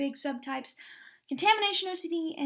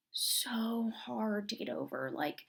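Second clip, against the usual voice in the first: slow whispered confession; whispered admission; 2 semitones lower, 5 dB quieter, slower than usual. Real, not acted. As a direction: let it slip out in a hush. Admitting a struggle.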